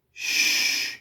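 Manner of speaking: long unvoiced "shh"; steady hushing hiss; shushing. A narrator's reading. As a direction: hush the wind with a drawn-out shh. Jesus hushing the wind in story.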